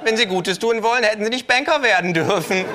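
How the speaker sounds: high, loud, cheeky kangaroo voice; brash kangaroo. Acted. Kangaroo voice again.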